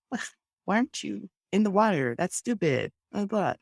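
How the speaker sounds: high, mocking, judgmental tone; mocking busybody. A stranger scolding a relaxer, lightly mocking and high. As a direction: sneer at the beach-lover's choice. Someone mocking you at the beach.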